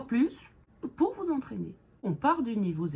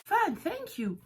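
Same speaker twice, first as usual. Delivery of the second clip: bright answer; 10 semitones higher, slower than usual. Shown as a demonstration. Models the reply.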